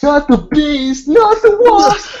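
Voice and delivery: high, slow, loud anguished wail; hammed-up horror-movie scream; Wicker Man scream. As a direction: howl like a man trapped with bees. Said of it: "Wicker Man".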